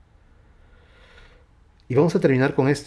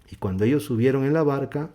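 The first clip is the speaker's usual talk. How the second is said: usual speaking voice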